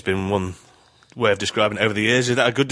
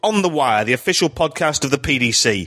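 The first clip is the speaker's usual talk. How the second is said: upbeat radio host opening read; podcast host intro; 5 semitones higher than usual. Acted. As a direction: open the show like a broadcaster. Podcast intro read over music.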